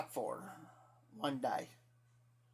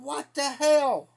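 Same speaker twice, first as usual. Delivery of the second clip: slow, drawn-out high "what the hell"; stunned disbelief, pitched well up; shocked outburst; 8 semitones higher, 3 dB louder, slower than usual. Retold or imitated. To himself at the empty fridge, stunned and high. Finding his last beer gone.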